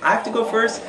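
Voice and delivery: high, loud disbelieving question; incredulous protest. Retold or imitated. Teen told by buddy to go first, incredulous.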